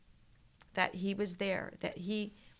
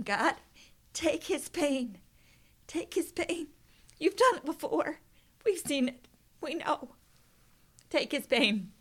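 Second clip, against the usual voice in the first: retold fervent prayer, much higher and louder; desperate prayer; 7 semitones higher, 5 dB louder than usual. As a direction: cry out to God with full urgency. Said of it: "praying like I have never prayed".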